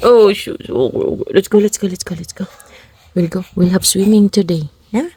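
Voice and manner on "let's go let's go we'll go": ordinary talk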